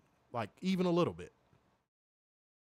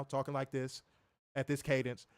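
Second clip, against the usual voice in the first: ordinary talk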